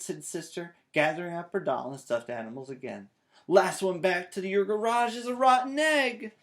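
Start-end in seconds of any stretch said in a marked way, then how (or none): 3.5-6.3 high, loud, gleeful dare; playful challenge. A narrator's reading. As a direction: race off laughing. Child races off playing.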